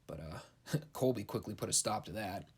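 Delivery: usual speaking voice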